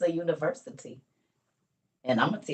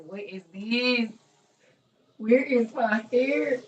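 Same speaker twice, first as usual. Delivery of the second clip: high, loud, slow distressed questions; retold panic; 9 semitones higher, 6 dB louder, slower than usual. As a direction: cry out in dismay at the lost hair. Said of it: "I said".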